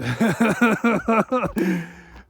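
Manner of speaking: a burst of loud, real laughter. Real, not acted. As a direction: laugh out hard, amused at himself. Laughs at his own quote.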